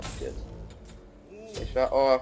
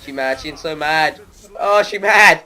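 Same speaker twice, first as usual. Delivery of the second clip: gloating; 9 semitones higher, 12 dB louder, slower than usual. Real, not acted. Gloating at an angry opponent.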